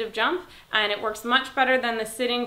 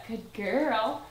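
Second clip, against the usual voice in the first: slow praise. Real, not acted. Trainer praising her dog for lying down. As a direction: stretch the praise out calmly.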